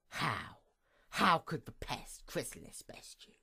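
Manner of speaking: hushed, taunting whisper of her thoughts; mocking whisper. Gloating over her fallen sister in a mocking whisper. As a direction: breathe the question out with scorn.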